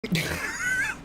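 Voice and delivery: shrill burst of laughter; high shrieking laugh. Real, not acted. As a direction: cackle in disbelief.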